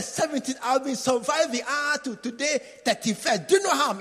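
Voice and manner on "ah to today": ordinary delivery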